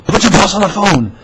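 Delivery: high, loud impression of an angry caller; yelling caller. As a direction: yell it like an irate prank-style caller. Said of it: "he starts yelling at you".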